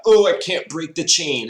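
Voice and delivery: high, loud mocking voice; hammed-up whiny Packers front office. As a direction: play the Packers as a high, loud, silly whiner. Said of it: "the Packers are gonna be like".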